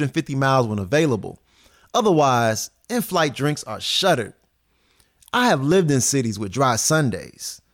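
upbeat news report delivery; news segment read. Acted.

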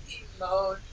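ordinary talk, nothing marked

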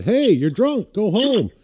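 loud, high call across the street. Retold or imitated. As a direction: holler it at the deer from the car. Yelling at a deer in street.

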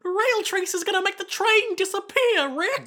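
high, loud, panicky Boston-Morty voice; Jacuzzi as Morty. Retold or imitated. Fan imitating dubbed Jacuzzi for her co-host, high Morty voice. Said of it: "Morty from Rick and Morty".

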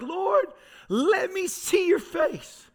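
high, slow, yearning plea; pleading cry to God. Retold or imitated. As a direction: stretch it out like a desperate prayer. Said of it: "crying out in Nashville".

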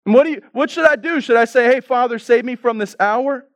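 high-pitched sarcastic mock plea. Troubled Jesus addressing people in the same spot, sarcastic. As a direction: toss out the question as plainly absurd. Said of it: "Do you hear the sarcasm?".

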